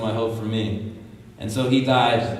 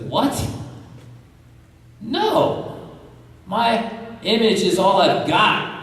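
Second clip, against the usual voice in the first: indignant mobster; 9 semitones higher, slower than usual. Retold or imitated. Mobster refuses pastor's request.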